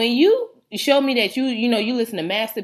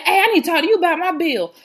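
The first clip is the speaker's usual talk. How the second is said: higher, pushy customer asking about his bill; mock customer voice; 9 semitones higher, 3 dB louder than usual. Customer cornering a worker about his bill, pushy. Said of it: "He was like".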